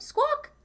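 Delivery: very high, loud squawk; chicken squawk. Acted. Chicken joining the ride, a very high squawk. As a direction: screech it like a hen. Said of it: "said Chicken".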